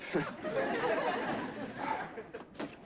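high breathy nervous laugh; nervous giggle. Acted. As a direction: giggle to cover the lie.

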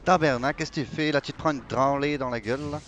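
mock Quebecer trash talk; Quebecois accent. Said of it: "Fais ton accent québécois". (accent québécois moqueur)

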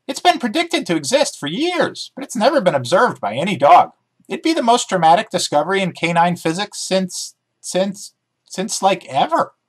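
chirpy dog character, keen to explain; eager talking dog. Acted. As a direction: bounce through it like an excited dog. Dog Emmy voiced in story.

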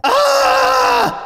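screaming goat bleat; high shrieking goat scream. Retold or imitated. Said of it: "That was my impression of a goat".

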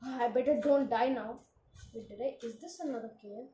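ordinary delivery

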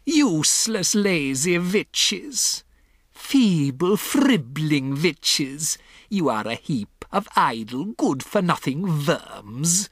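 put-on high, slow, accented tirade; drawn-out contempt with v for w; scolding witch yell. A narrator's reading. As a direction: berate them as worthless underlings. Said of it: "she yelled".

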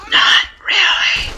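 slow, drawn-out whisper; hushed aside in a slow whisper. Real, not acted. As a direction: whisper it slowly, all breath and no voice. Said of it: "but I whispered it".

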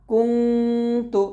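কুংতু with very slow, long nasal ং; ghunna. Shown as a demonstration. Teacher showing students ghunna, কুংতু with drawn-out nasal hum. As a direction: hum through the ং of কুংতু before releasing তু. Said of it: "অনুস্বর দিয়ে উচ্চারণটা করবো".

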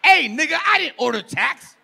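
over-the-top angry yelling at a bill; mock furious shout. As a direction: roar it like a customer who got overcharged. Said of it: "you guys roar, you guys get mad".

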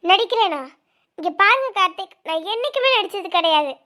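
loud, stung denial; indignant retort. Acted. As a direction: throw the accusation back at him. Accused of pretending, she retorts.

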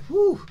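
a sudden high-pitched whew of strain; sharp high whew. Acted. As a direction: let the pressure hit you in one high breath. Jesus feels the pressure coming.

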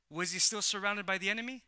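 normal talking voice

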